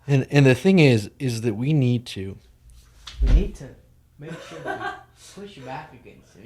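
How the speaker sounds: halting speech sinking very soft; fumbling, barely audible mock statement; mock mumbling politician. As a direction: fade away as if off the microphone. Mocking senator's mumbled, off-mic reading.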